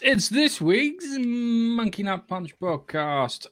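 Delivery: booming showman announcement; ringmaster. Acted. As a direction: roll up, roll up showman call. Podcast intro announcement.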